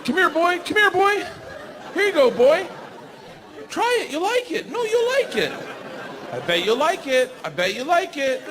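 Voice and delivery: high, coaxing voice luring an animal; high sing-song coaxing with a laugh; coaxing horse tamer. Retold or imitated.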